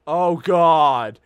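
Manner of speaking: loud, appalled exclamation; real dismay. Real, not acted. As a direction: blurt it out in loud dread. Reaction to drinking game idea.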